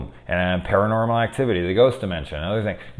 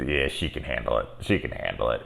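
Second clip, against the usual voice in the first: low dismissive mutter; 7 semitones lower, 5 dB quieter than usual. Retold or imitated. Dismissive inner voice.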